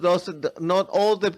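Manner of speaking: ordinary talk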